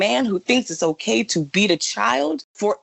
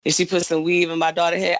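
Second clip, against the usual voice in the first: normal talking voice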